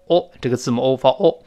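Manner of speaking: normal talking voice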